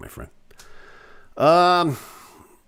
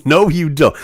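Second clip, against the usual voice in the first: a louder, firmer NO to shut it down; loud playful no; 4 semitones higher, 6 dB louder than usual. Acted. Host teasing a chat commenter, a louder firmer no. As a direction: punch the No harder, teasing.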